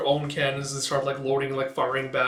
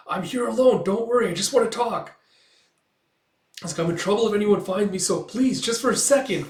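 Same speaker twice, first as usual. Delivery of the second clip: high, raised, insistent reassurance; urgent pleading; 7 semitones higher, 5 dB louder than usual. Retold or imitated. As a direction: plead for a moment of their time. Pleading with startled woman to talk.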